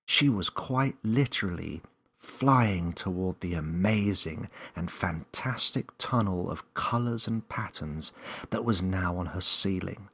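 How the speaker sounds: usual speaking voice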